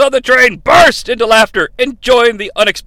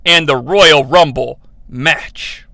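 ordinary talk, nothing marked

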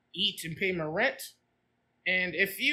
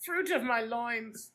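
Carrie's mom imagined greeting her daughter, hammed-up posh voice; imitating Carrie's mom; 3 semitones higher than usual. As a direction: ham up a stuffy mother. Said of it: "What would those conversations like?".

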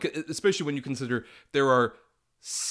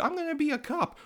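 put-on much higher, childish squeak; mock kid voice. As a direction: play the little boy, pitched way up. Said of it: "he's just like".

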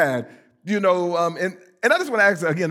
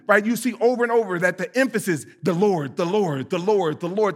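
ordinary talk, nothing marked

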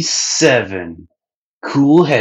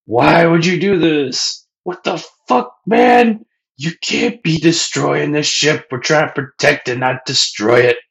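pissed Sanji; 7 semitones higher than usual. Retold or imitated. Sanji yelling at Luffy for wrecking the ship, angry and high. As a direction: yell at him in disbelief, voice way up. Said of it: "Sanji's pissed".

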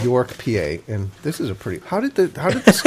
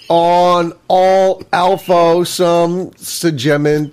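slow puzzled reading; 3 semitones higher, 6 dB louder, much slower than usual. Real, not acted. Struggling to read cursive.

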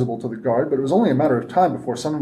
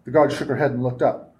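ordinary delivery, nothing marked

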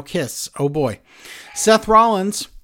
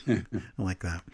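usual speaking voice

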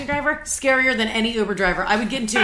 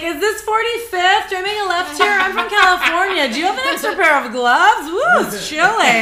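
chirpy lost tourist; 8 semitones higher, 6 dB louder than usual. Retold or imitated. Lost Californian asking around at midnight, hammed-up and high. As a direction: play up the clueless out-of-towner, high and loud. Acting out herself lost, phone out.

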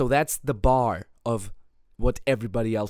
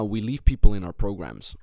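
ordinary delivery

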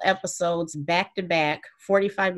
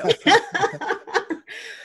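high chuckle; 14 semitones higher, 4 dB louder than usual. Real, not acted. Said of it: "that chuckling you hear".

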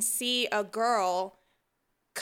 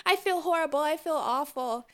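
higher, sheepish apology; apologetic man; 7 semitones higher than usual. Retold or imitated. Imitating the man still apologizing on the stairs, higher. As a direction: plead sorry, pitched up. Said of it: "he's just like".

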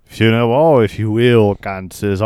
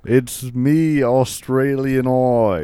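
slow, drawn-out Aussie self-introduction; lightly voiced Australian accent; 3 semitones higher, slower than usual. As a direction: announce yourself like a mock Aussie. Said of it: "Australian Eye, is that you?".

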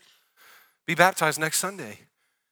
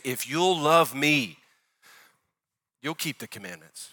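loving tone of Jesus. Retold or imitated. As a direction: draw out the love, then let the rest fall away gently. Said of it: "I believe the tone of Jesus here".

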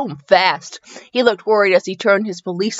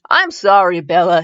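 usual speaking voice

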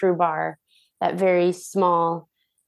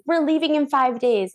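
excited news; 7 semitones higher than usual. Retold or imitated.

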